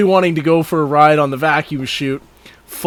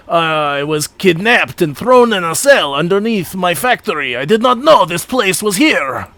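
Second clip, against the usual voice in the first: put-on, clumsy French accent; bad French accent. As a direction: tell your tale as a hammy Frenchman. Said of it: "do a really bad French accent".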